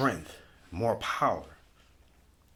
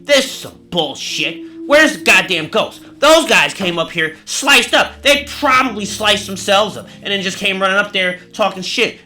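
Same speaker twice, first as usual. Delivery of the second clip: loud, higher, mocking complaint; scoffing doubter; 7 semitones higher, 10 dB louder than usual. Skeptical visitors mocking the ghost, loud scoff. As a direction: sneer at the fake ghost show loudly. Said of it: "And then they'll be like".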